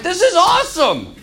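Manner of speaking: high-pitched, thrilled outburst; excited enthusiasm. Acted. Preacher answers gloomy churchgoers with his own reaction, excited.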